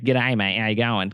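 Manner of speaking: lightly put-on broad Aussie greeting; a casual mock-Aussie hello; broad Australian accent. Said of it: "we do have Australian accents".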